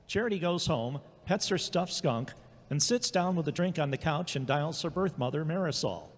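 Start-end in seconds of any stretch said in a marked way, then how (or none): none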